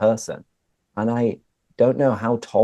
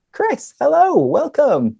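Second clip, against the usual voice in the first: excited greeting; 6 dB louder, slower than usual. Real, not acted. Host greets guest.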